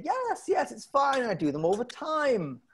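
high, drawn-out reassurance; breezily reassuring doctor. Retold or imitated. Doctor reassuring a patient about routine surgery, breezy reassurance. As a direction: wave the worry away in a high voice. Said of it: "and he said".

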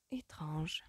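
soft murmur under the breath; a soft puzzled murmur. A narrator's reading. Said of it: "murmura Sophie".

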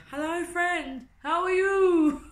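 very high, loud, drawn-out sing-song hello; mock greeting. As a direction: shout a silly cheery greeting from afar. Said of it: "I'll stand there and I'll say".

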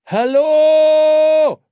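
loud, high, drawn-out call; a voice calls through the whistle; distant call. Acted. A far voice calls loudly through the train whistle. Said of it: "rief die Stimme".

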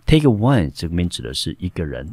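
usual speaking voice

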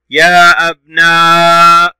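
يا أبنائي slowly, every aa and the final ii stretched; high, drawn-out يا أبنائي; long vowels. Shown as a demonstration. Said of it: "صوت طويل ممدود بالياء".

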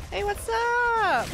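drawn-out high sing-song hello; high, slow mock greeting. Gamer mockingly greets an enemy, slow and high.

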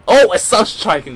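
sudden excited shout. Real, not acted. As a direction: call it out with a burst of excitement. Caster reacts to big play.

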